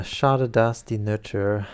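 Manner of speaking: self-mocking German read in a French accent, slow; terrible German. Shown as a demonstration. Reader warns chat before she reads Goethe in bad German with French accent. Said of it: "do you want to hear my terrible German?".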